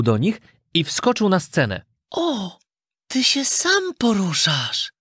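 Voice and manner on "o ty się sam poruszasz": high, slow doll voice; amazed doll calling out. A narrator's reading. Doll seeing a puppet move alone, high amazed call. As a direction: marvel in a high, drawn-out puppet voice. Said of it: "zawołała jasnowłosa lalka".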